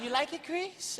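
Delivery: a soft high Michael asking a friend. Retold or imitated. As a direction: imitate Michael's gentle high voice.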